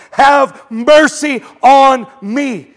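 loud, slow, high cry; calling out slowly for mercy; yelled plea. Retold or imitated. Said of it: "he yelled at the top of lu-, his lungs".

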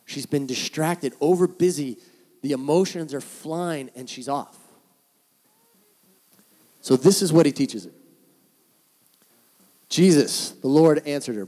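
normal talking voice

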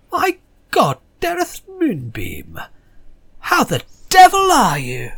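put-on a stuck-up posh accent, drawn out. Nikki the receptionist greeting an old bandmate, posh accent. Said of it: "where do you get that accent".

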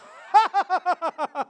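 loud, high staccato cackling laugh; demonstrative laugh. Shown as a demonstration. Preacher showing what to do when lies come, loud laugh. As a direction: burst out in exaggerated mocking laughter. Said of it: "when the lies come, what do you do".

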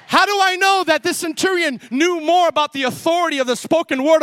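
high, driving pulpit voice; forceful preaching. Real, not acted. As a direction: preach it urgently to the congregation.

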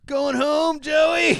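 loud, high call, a sing-song goodbye; playful shout. Acted. Teasing a friend, loud high calling out.

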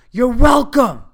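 sarcastic shout across the lot; yelled you're welcome. Retold or imitated. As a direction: yell it after him, full blast, high and loud. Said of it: "And loudly I go".

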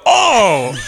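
mock shocked "oh"; startled outcry. Podcaster re-enacting his reaction to the scene, startled outcry. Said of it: "This scene made me go".

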